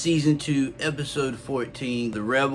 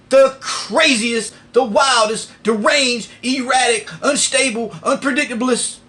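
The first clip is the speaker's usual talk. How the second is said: loud boasting; 12 semitones higher, 7 dB louder than usual. Real, not acted. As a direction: shout each word like a hype man. Shouted list of boasts.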